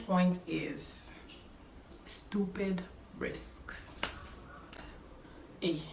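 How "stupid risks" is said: ordinary delivery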